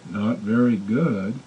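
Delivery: slow, low and soft reluctant answer; timid mumble. Retold or imitated. Imitating the phobic man, slow, low and soft. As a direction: barely get it out, deflated.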